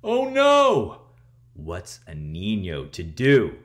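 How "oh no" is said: high, loud "oh no"; alarmed cry. Acted. Reader reacting to the next wrestler, high alarmed cry. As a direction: gasp it out in mock alarm. Narrator reacts to new foe.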